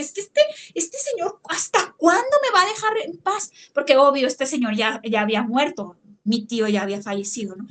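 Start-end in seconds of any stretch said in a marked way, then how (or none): 0.0-3.5 retold anger. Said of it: "se enojó y dije".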